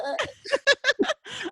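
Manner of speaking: high-pitched cackle of amusement; real laugh. Real, not acted. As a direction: burst out laughing at your own story. Cracking up at own story.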